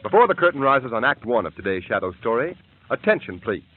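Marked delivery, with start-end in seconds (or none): none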